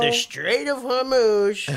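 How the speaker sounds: high, slow Trump drawl; Trump impression. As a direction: drag it out like Trump. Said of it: "let's see his Trump".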